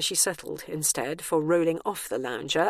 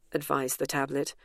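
ordinary delivery

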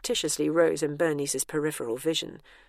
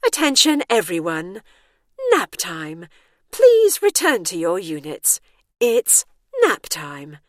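loud public-address announcer; announcement; 8 dB louder than usual. A narrator's reading. Ceiling announcement calls residents to nap, loud and drawn out. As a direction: call it out to a whole building, loudly.

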